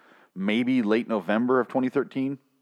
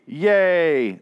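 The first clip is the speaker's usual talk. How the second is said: sarcastic yay; 7 semitones higher, 4 dB louder than usual. Acted. Sarcastic cheer at pointless scene.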